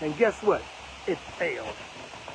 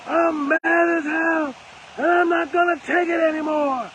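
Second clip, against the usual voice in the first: movie rant quote; 7 semitones higher than usual. Retold or imitated. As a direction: declaim it like the movie character at his limit. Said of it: "Where the dude said".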